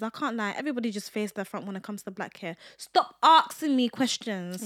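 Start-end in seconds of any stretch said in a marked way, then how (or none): 2.8-4.7 slow deliberate stop order; slow fed-up telling-off; slow exasperated command. Pretend. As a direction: spell it out slowly, fed up. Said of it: "telling off curious coworkers".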